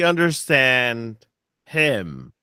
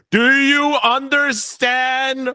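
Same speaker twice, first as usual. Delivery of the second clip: loud slow yelling; 8 semitones higher, much slower than usual. Acted. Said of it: "I'm kinda yelling this".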